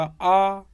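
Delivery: आ held long and loud; long vowel. Shown as a demonstration. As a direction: sound out a full, long आ. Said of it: "बड़ा बना आ".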